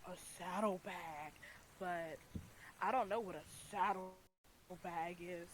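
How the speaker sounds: slow, eerie vendor voice; drawn out like a creepy salesman; creepy whisper voice. Acted.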